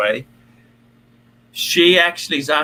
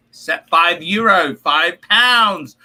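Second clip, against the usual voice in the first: ordinary delivery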